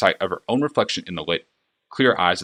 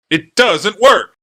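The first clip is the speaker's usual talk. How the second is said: much higher, louder, slow furious hiss; hissed outburst; 11 semitones higher, 10 dB louder, much slower than usual. A narrator's reading. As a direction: spit it out in panic. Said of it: "hissed the clockmaker".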